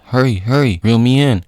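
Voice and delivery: mock-panicked plea; acted urgency. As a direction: call for help as if dangling. Rocket hanging outside the jet.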